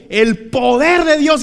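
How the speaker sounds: high, loud, drawn-out proclamation; slow, soaring imitation of a preacher shouting; preacher's shout. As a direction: cry it out like an impassioned preacher. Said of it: "y gritaba".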